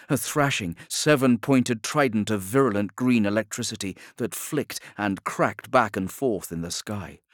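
plain reading voice

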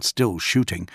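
ordinary delivery

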